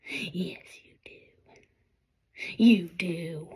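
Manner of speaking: soft whispered reassurance. Real, not acted. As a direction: whisper it like a secret encouragement. Whispered reassurance after "Yes, you do.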